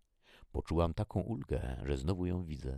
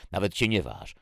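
loud, raised warning; threatening; 5 semitones higher, 9 dB louder than usual. A narrator's reading. Jackie aims the gun at her sister, threatening. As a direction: dare her to move, angrily.

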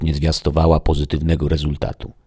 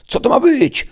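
indignant, very high shriek; high-pitched yelling; 7 dB louder than usual. A narrator's reading. Chief gadoid outraged at a mere human, yelling. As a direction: yell it high in disbelief. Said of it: "wrzeszczał naczelny gadoid".

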